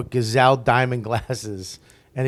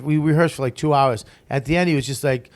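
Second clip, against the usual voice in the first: usual speaking voice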